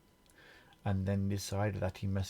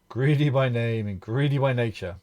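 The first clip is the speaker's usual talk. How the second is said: big mocking bellow, louder; giant's bellow; 4 semitones higher, 10 dB louder than usual. A narrator's reading. Giant mocking Mister Greedy, loud bellow. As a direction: roar it like a laughing giant. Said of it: "he bellowed".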